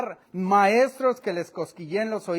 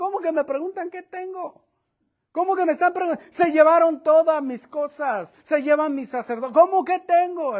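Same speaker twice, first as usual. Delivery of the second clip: much higher, louder frantic protest; desperate outcry; 8 semitones higher, 5 dB louder than usual. Acted. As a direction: cry out, robbed and frantic. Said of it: "Está desesperado el ingrato". (grito desesperado de Micaía)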